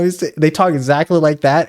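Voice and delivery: ordinary delivery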